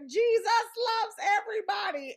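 high, loud proclamation; mom shouting. Retold or imitated. Mom stepping between fighters, loudly proclaiming. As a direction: call it out over the fight.

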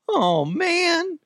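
very high, pubescent cartoon squeak; drawn-out cracking falsetto; Shaggy. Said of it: "like you're going through puberty".

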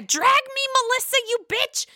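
high, loud hammed-up taunting; mock fight shout. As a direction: yell it as an imitated catfight challenge. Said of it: "Is it gonna be a".

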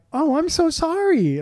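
sweet lady. Retold or imitated. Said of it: "sweet, nice Canadian lady being like".

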